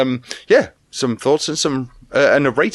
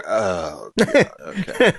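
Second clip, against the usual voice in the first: dismayed groan; 2 semitones lower, much slower than usual. Retold or imitated. Reviewer mimicking his own dismay at the film. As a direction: play the sinking realisation of a bad film. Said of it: "And then I go".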